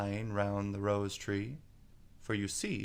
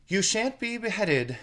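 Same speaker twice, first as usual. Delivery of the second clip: much higher, louder promise; protective Alice; 11 semitones higher, 8 dB louder than usual. A narrator's reading. Alice reassures the doomed gardeners. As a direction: shield them.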